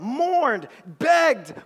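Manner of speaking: ordinary talk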